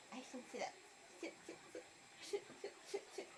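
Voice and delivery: soft unvoiced whisper. Real, not acted. Coaxing baby to sit, whispered.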